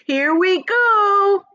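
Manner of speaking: high, loud, slow stretched cry; excited shout. A narrator's reading. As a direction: squeal as the ground moves. Creature shouts as room lurches.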